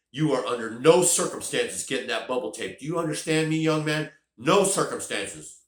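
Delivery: loud, firm scolding, slowing on the last words; stern mom. Retold or imitated. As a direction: lay down the law to your son. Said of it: "looked at him and said".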